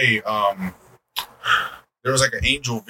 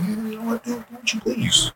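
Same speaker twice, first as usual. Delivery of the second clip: easygoing dad; 8 semitones higher, 3 dB quieter than usual. Retold or imitated. Dad voiced in story.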